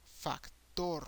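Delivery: "factor" as fac-TOR, stress on the last syllable; Spanish "factor", open vowels, falling end; Spanish pronunciation. Shown as a demonstration. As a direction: slowly land on a long TOR in "factor". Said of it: "make an emphasis in the last syllable".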